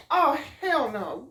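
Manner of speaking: retold shocked outburst; appalled refusal. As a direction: drag it out in loud disbelief. Reacting to psych ward news.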